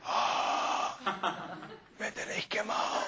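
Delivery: faint, breath-only groaning whisper; mock invalid's hushed gasp; English Patient impression. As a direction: wheeze it out like a bedridden burn patient. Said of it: "mi imitación del paciente inglés".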